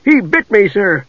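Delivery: high, anxious guard; truly worried. Acted. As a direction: complain worriedly to a superior. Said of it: "now sounding truly worried".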